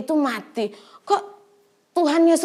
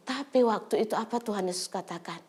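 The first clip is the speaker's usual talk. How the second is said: usual speaking voice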